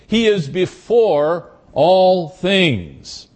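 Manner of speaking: "He" given weight, said slowly; stress on He. Shown as a demonstration. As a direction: lean hard on "He", then unhurried. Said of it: "The he is emphatic here".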